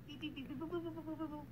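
soft spoken phone beeps; dialing beeps. Acted. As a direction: mimic dialing a phone quietly.

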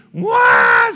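loud mock wail of a fallen child; imitated toddler scream. Little Susie fallen off the bed, screaming. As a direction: burst out bawling like a little girl. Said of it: "She's screaming".

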